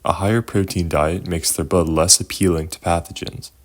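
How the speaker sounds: ordinary talk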